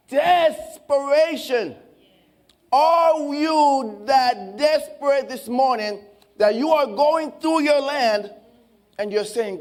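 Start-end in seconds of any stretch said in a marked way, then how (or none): none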